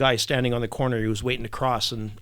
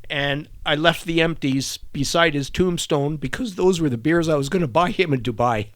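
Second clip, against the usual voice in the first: ordinary talk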